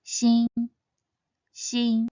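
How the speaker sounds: "Xin" held high and level; first tone. Shown as a demonstration. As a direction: keep "Xin" flat and high. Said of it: "read in the first tone".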